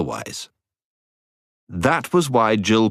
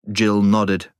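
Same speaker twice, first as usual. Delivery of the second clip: ordinary delivery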